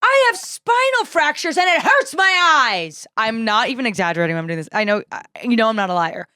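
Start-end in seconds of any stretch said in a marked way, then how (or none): 0.0-3.1 high, drawn-out outraged wail; hammed-up elderly tirade, each word stretched; impression of screaming older woman. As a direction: start at full blast, imitating her. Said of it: "I'm doing a verbatim impression".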